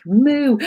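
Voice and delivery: a quick high moo; comic moo thrown in; playful cow moo. Pretend. Imitates a stubborn cow.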